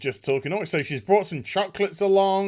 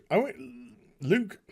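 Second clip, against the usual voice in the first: usual speaking voice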